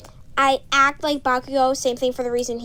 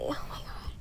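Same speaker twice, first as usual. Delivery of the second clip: whispered; 15 dB quieter than usual. Real, not acted. Exasperated whispered aside.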